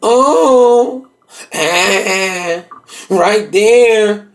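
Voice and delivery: drawn-out imitated moans; mock moaning. Man mimicking what he hears through the wall, mock moaning. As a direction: stretch each moan out, hammed-up. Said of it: "but I'm hearing shit".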